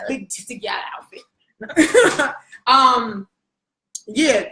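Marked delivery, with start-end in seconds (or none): 0.1-2.3 slow, drawn-out, laughing; showing off to the friend; relishing it. Real, not acted. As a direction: savor every word of the outfit name. Savoring the phrase.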